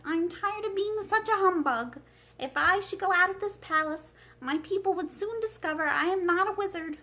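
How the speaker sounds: a hint of a thoughtful old wizard; Oz's voice. The wizard shares his balloon plan with Dorothy, lightly voiced.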